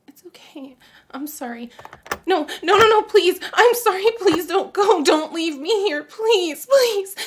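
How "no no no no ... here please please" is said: much higher, louder, desperate begging; panicked pleading. Acted. Mother frantically begging her son to stay. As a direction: cry out, terrified he'll go.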